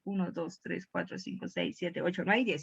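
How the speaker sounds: low, very soft counting; counting under breath. Real, not acted. Teacher rechecking there are ten, quiet self-directed count. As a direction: count quietly to yourself, checking.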